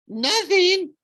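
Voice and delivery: high squeaky falsetto. A narrator's reading. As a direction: squeak it out in a fake girly voice. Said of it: "Grover said in his falsetto voice".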